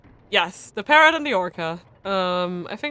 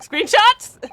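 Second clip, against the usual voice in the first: excited call; 13 semitones higher, 8 dB louder than usual. Real, not acted. Player's excited reaction.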